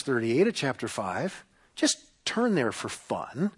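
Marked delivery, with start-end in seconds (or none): 1.7-3.5 soft, slow, dry invitation; deadpan, as if this will be fun; sarcastic. Real, not acted. Preacher sends congregation to a hard verse, sarcastic. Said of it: "it's totally sarcastic".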